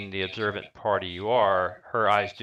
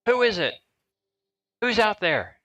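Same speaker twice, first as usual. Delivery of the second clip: high, louder woman's voice through the door; feminine voice; 13 semitones higher, 5 dB louder than usual. Acted. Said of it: "Clearly a feminine voice says".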